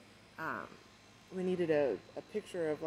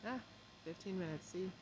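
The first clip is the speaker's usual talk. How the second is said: ordinary delivery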